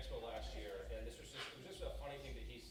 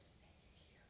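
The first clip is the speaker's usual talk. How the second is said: whispered; 17 dB quieter than usual. Retold or imitated. Fan whispering at the hotel door.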